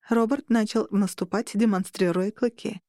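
ordinary delivery, nothing marked

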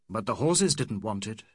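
usual speaking voice

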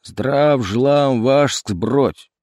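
very slow, drawn-out clipped chorus; soldiers' chorus reply. Acted. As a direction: chant it like a line of soldiers.

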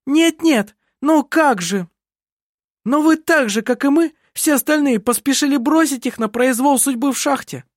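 indignant outburst; heated accusation. A narrator's reading. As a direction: fling the blame back at all of them. Heated family quarrel, accusing the others.

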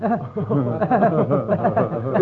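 short cruel royal laugh; malicious laugh. Acted. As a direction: laugh at your own nasty joke. King laughs at own cruelty. (kwaadaardige lach)

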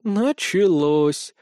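very slow, weary groan of a word; resigned sigh. Acted. Pets sigh at the bedtime quarrel, resigned. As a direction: give up on the squabble with a sigh. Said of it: "обречённо вздохнули".

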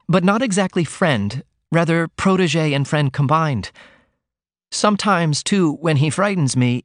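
put-on shy young daughter pouring out her worries; young woman Ruth. A narrator's reading. Ruth confides in her mother.

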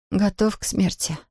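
сонно, тихо бормочет «Готов к смерти»; sleepy mumble. Acted. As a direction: mumble it half-asleep. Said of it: "сонно пробормотала она". (сонное бормотание)

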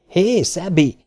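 high yell across distance. Acted. Donovan hails the distant robot, yelling. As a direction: yell to the robot far away. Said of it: "üvöltötte Donovan".

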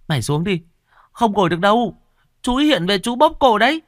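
put-on high, rising urgent kid's warning; scared warning. A narrator's reading. Frightened child warning her friend off the grave, high urgent voice. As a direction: hurry her off the grave before the ghost comes.

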